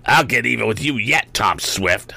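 raging threat of a foiled villain; in a rage. A narrator's reading. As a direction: shake a fist and vow revenge. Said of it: "cried out in a rage".

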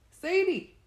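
high, loud alarmed yell. A narrator's reading. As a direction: cry out her name across a distance. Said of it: "I yelled".

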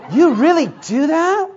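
high, slow, mocking voice for the guy; mock him as a prissy young man; mocking girly man. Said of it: "the girly man said".

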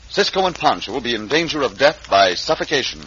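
ordinary talk

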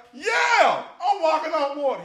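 high, loud, hammed-up triumph; acted gleeful yell; exultant shout. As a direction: crow it out in amazement. Peter boasting as he walks out.

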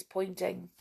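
ordinary delivery

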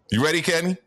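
ordinary talk

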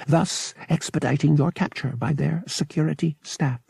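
high, mechanical, formal droid voice; officious droid. A narrator's reading. Watch droid warns stowaway of capture, officious and mechanical. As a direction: recite the duty like a polite robot.

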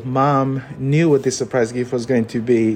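ordinary delivery

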